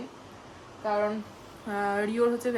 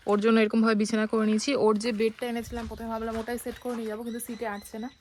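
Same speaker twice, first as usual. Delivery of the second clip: ordinary talk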